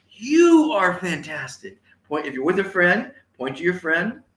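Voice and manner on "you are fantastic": loud, high, stretched-out YOU; enthusiastic rally call. Shown as a demonstration. Audience told to say it to a friend, enthusiastic cheer. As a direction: cheer it slowly, punching the first word. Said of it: "now I want you to say".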